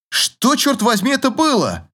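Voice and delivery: high startled shout. A narrator's reading. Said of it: "прокричал Артур".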